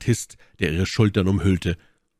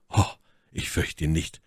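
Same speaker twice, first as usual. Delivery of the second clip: ordinary delivery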